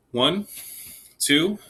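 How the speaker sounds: teacher's cheerful count-in. Shown as a demonstration. Teacher counting students in before playing together. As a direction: count the class in, bright and even. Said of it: "here we go".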